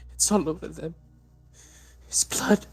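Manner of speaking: high hushed horror; haunted and frantic; panicked breakdown. Acted. Mother in breakdown.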